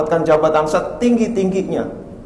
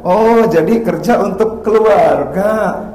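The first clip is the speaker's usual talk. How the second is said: higher, slow, loud trainer voice; trainer's reply; 5 semitones higher, 4 dB louder, much slower than usual. Retold or imitated. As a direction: echo the foreigner slowly. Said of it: "Kata dia gitu kan". (meniru bule, pelan dan keras)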